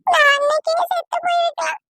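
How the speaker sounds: high, breaking voice of despair; tearful self-blame. Acted. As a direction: sob out the guilt, wishing she had died. Wife blames herself, wishes death. (அழுகையுடன் உயர்ந்த குரலில் தன்னைத் தானே குற்றம் சாட்டுதல்)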